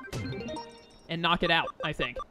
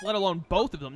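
normal talking voice

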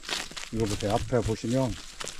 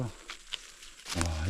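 ordinary talk, nothing marked

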